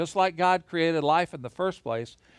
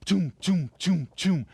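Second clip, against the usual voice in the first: hammering noise. Retold or imitated. Builders hammering on the tower.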